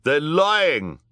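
high, loud accusation; crying out. A narrator's reading. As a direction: cry it out against them. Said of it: "cried Ernest".

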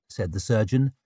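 ordinary delivery, nothing marked

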